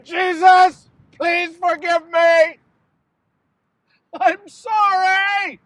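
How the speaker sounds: high, slow, crying apology; tearful plea. Acted. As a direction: beg forgiveness, voice rising into a wail. Judas begging Jesus for forgiveness.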